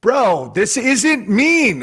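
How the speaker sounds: slow, drawn-out innocent protest; mock protest. Answering people who misread his tweets, slow and innocent. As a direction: stretch it out, playing innocent. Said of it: "I'm like".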